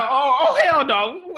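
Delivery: high mock-indignant hell no; hammed-up annoyed exclamation; mock outrage. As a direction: play it up like someone cut the line. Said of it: "You like".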